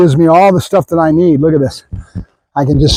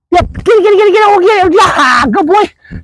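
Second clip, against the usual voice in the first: excited urging; 12 semitones higher than usual. Real, not acted. Trainer urging his dog to grab the toy: high, excited cheering.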